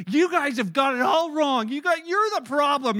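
put-on loud, defiant teen blaming parents; defiant teenager. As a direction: throw the blame back at them.

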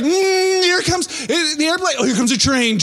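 high-pitched mock coaxing with engine noises; playful feeding voice. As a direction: coax a baby with the spoon. Parent coaxing with airplane, train.

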